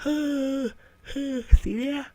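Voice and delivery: talking through a yawn; stretched, high yawn-talk. Real, not acted. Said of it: "I start yawning".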